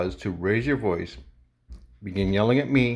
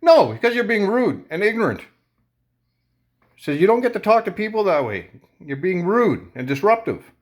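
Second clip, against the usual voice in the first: much higher, louder angry yelling; raised voice; 11 semitones higher, 5 dB louder than usual. Retold or imitated. Arguing with the customer in the store, much higher and louder. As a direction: yell right back at her. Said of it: "my voice is raised".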